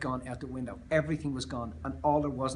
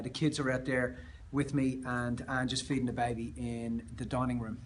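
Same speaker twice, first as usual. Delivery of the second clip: ordinary delivery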